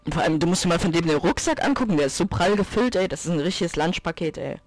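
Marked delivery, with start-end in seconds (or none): none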